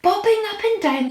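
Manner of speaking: high, loud shocked outburst; alarmed cry. A narrator's reading. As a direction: cry out in disbelief. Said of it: "they cried".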